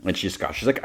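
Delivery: ordinary delivery, nothing marked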